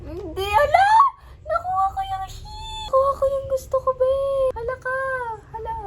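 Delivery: high, loud squealing cry of joy; ecstatic shriek. Real, not acted. Vlogger getting the wished-for sheep, ecstatic shriek. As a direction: shriek as if you cannot believe your luck. Gets the sheep she wanted.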